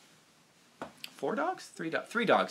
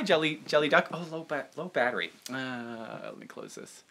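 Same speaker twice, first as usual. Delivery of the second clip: normal talking voice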